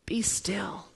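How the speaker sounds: hushed, falling into a whisper; soft whispered command, spaced out. Acted. Preacher voices Jesus calming the storm, whispering. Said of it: "calms the storm with a whisper".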